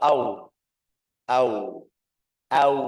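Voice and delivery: AU gliding into a firm rounded U; lips pursed. Shown as a demonstration. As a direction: push the lips forward on AU. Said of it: "sampai munyung bibir".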